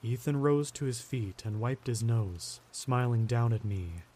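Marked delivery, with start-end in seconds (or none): none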